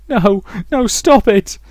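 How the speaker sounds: high, loud, desperate scream; screaming back. A narrator's reading. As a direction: cry out to silence the voice. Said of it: "She screamed back at that last voice".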